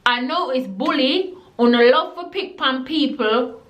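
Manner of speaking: usual speaking voice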